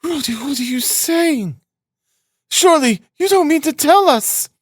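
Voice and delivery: very high, shocked mother's cry; alarmed. A narrator's reading. Mother shocked by her son's words, high alarmed cry. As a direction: gasp the question out in alarm, pitched high. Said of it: "began in alarm".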